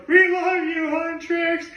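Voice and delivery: high, gushing fan call; mock fan cheer. Big guys to their idols Huntrix, high adoring cheer.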